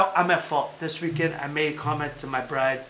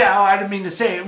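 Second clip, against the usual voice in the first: mock excuse; 5 semitones higher, 11 dB louder than usual. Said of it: "don't say".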